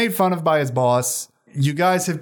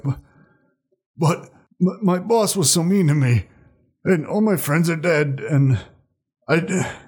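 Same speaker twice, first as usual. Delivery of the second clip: higher-pitched, halting sulky complaint; dejected henchman; 4 semitones higher than usual. Acted. Beaten henchman pleading his case to the party, pitiful and halting. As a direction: stammer it out like a wronged underling. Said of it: "so he looks up at you".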